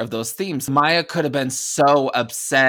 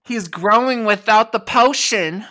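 alarmed ranger; 9 semitones higher, 7 dB louder than usual. Acted. Ranger alarmed at growing monster.

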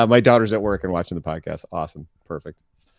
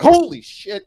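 shrieking high holy shit; high-pitched startled yelp; 7 dB louder, slower than usual. Retold or imitated. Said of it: "He's like".

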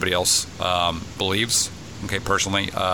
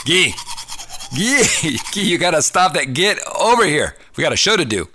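calling out; 7 semitones higher, 3 dB louder than usual. Real, not acted. Calling co-host over to start.

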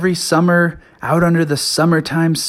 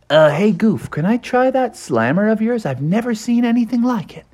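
higher-pitched eager little character voice; Froggy the curious frog; 9 semitones higher than usual. A narrator's reading. Froggy asks to try Goof's slammer, high and eager. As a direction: ask eagerly in a raised frog voice.

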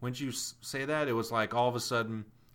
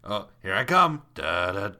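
mock Jaws theme; 7 dB louder than usual. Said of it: "imagine if he does the score".

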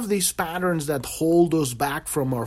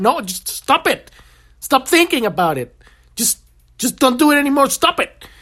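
high, loud hammed-up yelling; mock shout; 10 semitones higher, 5 dB louder than usual. Therapist shouts down the protesting patient, high and loud. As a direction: bellow the orders at him, mock exasperated.

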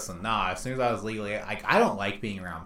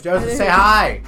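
loud, raised call across the room; 11 semitones higher, 16 dB louder than usual. Real, not acted. Calling to the stepdad to say hi on camera. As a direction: call him over cheerfully.

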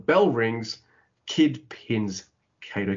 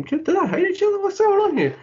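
high, louder astonished question; much higher incredulous outburst; amazed; 4 dB louder than usual. Real, not acted. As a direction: sound amazed they're this violent. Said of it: "asks in disbelief".

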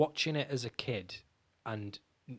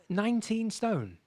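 amazed; 10 semitones higher, 4 dB louder, slower than usual. Real, not acted. Amazed at the weight loss.

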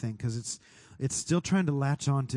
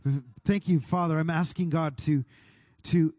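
fervent prayer, declaring and thanking; 4 semitones higher than usual. Acted. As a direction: lift it up like a worship leader praying. Prays aloud over the room.

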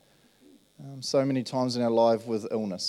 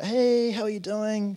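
high, slow, cheery hello; retold bright greeting; 11 semitones higher, 3 dB louder, slower than usual.

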